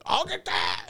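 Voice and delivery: high-pitched holler; mimicking Dad's shouted remark; imitated yelling. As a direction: call it over a running snowblower. Dad shouting over snowblower.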